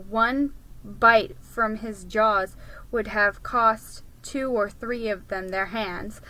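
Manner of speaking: ordinary delivery, nothing marked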